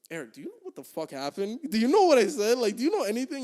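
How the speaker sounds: building to high, loud questions; urgent questioning. Retold or imitated. Asking his friend what the fuck happened, urgent and rising to loud. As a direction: start near normal, then burst out loud and high.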